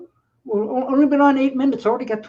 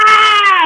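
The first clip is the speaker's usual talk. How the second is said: put-on loud shrill kid scream; 12 semitones higher, 12 dB louder than usual. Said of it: "all you hear is".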